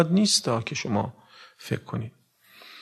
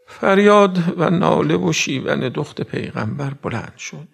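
usual speaking voice

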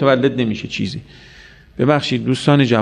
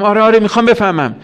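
eager yes; 8 semitones higher, 3 dB louder, faster than usual. Acted. Eager listener in acted dialogue.

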